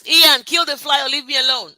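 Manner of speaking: loud, exasperated yell; shout across the room. Real, not acted. Speaker yells at Ian about the buzzing fly, annoyed shout. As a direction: snap loudly at someone in the next room.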